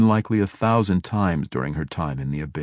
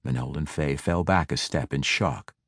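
plain reading voice

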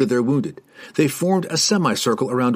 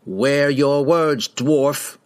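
slow, higher warning; stern warning; 4 semitones higher, much slower than usual. A narrator's reading. Rider cautions the insulting dwarf, stern warning. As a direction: warn him with each word weighed. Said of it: "the rider warned".

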